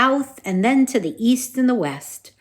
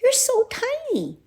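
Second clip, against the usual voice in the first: cooing. Acted. Princess coos at mouse.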